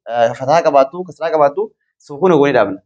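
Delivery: ordinary talk, nothing marked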